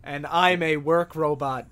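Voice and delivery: ordinary talk, nothing marked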